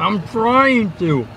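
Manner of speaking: high, slow strained whine; whining with mouth full. Real, not acted. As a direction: whine through sticky caramel. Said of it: "How are you still chewing".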